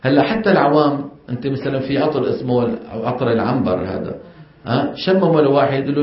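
ordinary talk

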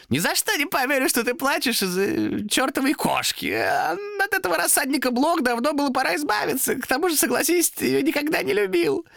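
very high creature voice; scornful demon. Acted. Demon belittles Томас's grief for the cat, scornful. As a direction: scoff at his grief as the demon.

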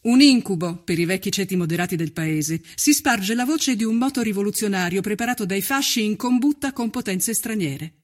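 ordinary talk, nothing marked

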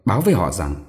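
ordinary delivery, nothing marked

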